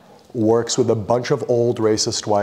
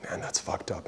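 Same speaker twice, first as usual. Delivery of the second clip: a hushed, breathy whisper; whispered sympathy; 3 semitones lower, 15 dB quieter than usual. Retold or imitated. The cop whispering in sympathy after hearing about KJ. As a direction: whisper it like a shocked aside.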